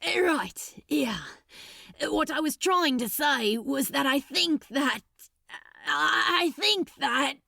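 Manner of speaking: halting voiced demon, stumbling over words; a lightly voiced Crowley, struggling to say it; Crowley fumbling for words. A narrator's reading.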